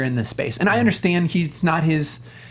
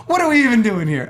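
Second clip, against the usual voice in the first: laughing exasperation; 6 semitones higher, 3 dB louder than usual. Retold or imitated. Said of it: "I was like".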